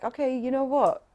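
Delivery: defiant realisation. Retold or imitated. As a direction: toss it off boldly, refusing to be scared. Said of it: "I felt like".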